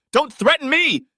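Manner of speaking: high, loud, forceful outburst; furious shout. A narrator's reading. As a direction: shout it in sudden fury. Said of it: "I screamed as he pushed".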